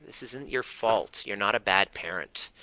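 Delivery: ordinary talk, nothing marked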